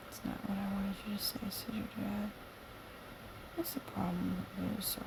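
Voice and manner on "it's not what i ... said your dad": whisper. Acted. Whispered read line.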